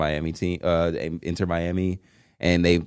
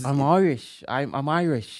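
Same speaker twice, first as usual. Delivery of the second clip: higher, lilting put-on Irish; attempted Irish accent; 6 semitones higher than usual. Shown as a demonstration. Co-host takes a turn attempting an Irish accent. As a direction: try a hammy Irish accent. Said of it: "I didn't get to hear you, either of you attempt it".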